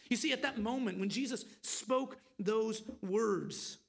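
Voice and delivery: ordinary talk, nothing marked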